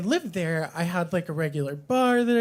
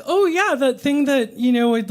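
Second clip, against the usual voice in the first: high, louder mock sarcasm; sarcastic; 6 semitones higher, 5 dB louder than usual. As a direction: drip with fake enthusiasm. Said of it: "And I was like".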